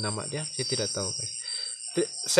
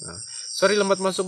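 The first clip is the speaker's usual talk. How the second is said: normal talking voice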